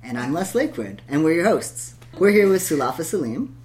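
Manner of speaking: ordinary delivery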